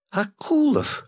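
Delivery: high excited cry of recognition; excited shriek. Acted. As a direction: shriek the name, starstruck. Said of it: "закричала она".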